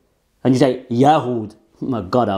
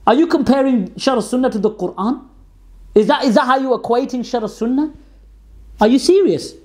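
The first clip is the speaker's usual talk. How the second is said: incredulous; 9 semitones higher than usual. Real, not acted. Incredulous rebuttal of opponent.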